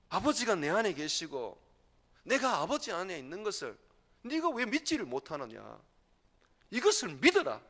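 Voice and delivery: impassioned command. Acted. Preacher voicing Jesus' rebuke.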